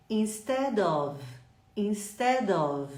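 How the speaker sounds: "Instead of" slow, the d running into "of"; linked instead of. Shown as a demonstration. Teacher leading students in repeating the phrase, slowly. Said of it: "Repete comigo".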